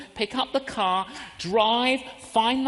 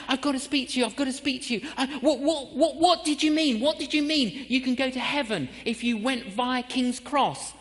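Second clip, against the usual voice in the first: eager mourners; 5 semitones higher than usual. Retold or imitated. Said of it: "people just flocked. Like".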